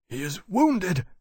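sharp, alarmed exclamation. A narrator's reading. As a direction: snap it out in alarm. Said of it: "Lawrence said sharply".

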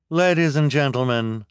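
high, loud official announcing; officer voice. Acted. Officer addresses the crowd.

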